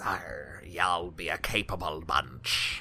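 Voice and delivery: low, slow pirate growl "Arr, y'all be a capable bunch". Acted. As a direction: play a gruff old pirate. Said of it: "nods and says".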